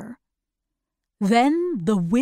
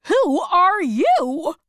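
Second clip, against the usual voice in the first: slow, higher called-out question; shouted question; 8 semitones higher, 4 dB louder, slower than usual. Acted. Tin Woodman demanding who the old man is, slow shout. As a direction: Tin Woodman calls out to a stranger, slowly. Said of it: "he shouted".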